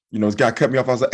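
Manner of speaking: usual speaking voice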